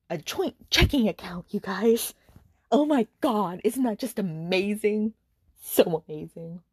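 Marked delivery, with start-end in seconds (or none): 2.7-6.6 mock amazement, slowing down; sarcastic awe. Real, not acted. Reviewer to viewers on a joint checking account, sarcastic awe. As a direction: drag out the fake enthusiasm. Sarcastic cheer at a weak ending.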